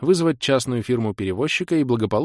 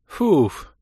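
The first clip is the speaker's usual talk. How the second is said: high, loud phew of relief; sharp puff of relief; relieved exhale; 8 semitones higher, 5 dB louder than usual. A narrator's reading. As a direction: breathe out, reassured. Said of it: "успокоенно выдохнула Кира".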